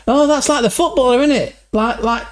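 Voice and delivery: high, put-on Scottish-Irish accent; [name]'s Celtic accent. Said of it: "the same guy, [name]".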